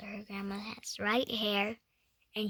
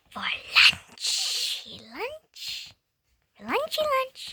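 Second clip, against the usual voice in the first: silly voice; 14 semitones higher, 6 dB louder, much slower than usual. Pretend. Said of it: "That's what I call it".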